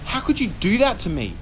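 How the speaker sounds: high, loud indignant demand; angry. A narrator's reading. Sister scolds her brother, high and loud. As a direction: scold him hard.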